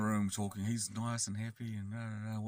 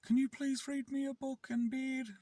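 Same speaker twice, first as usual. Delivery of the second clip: very high, sweet mock child voice; little kid. Kid asking carer for a bedtime story, tiny pleading voice. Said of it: "he just turned into a little kid".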